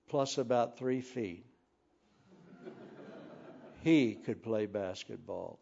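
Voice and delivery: normal talking voice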